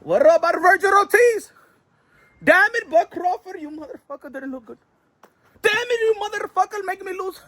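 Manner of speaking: high, cursing mock rant; mock furious sheikh. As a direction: rage at him like a sponsor losing money. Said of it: "He was like".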